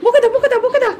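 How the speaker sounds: high, rapid-fire singsong chant; playful egging-on. Real, not acted. Urging the pet to scold, playful high baby talk.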